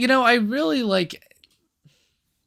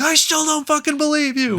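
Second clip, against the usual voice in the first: much higher, louder yelling; screaming on the phone; 3 dB louder than usual. Retold or imitated. Retelling screaming at a friend over the phone, loud yell. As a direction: shout it in disbelief down the phone. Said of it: "I started screaming at you on the phone".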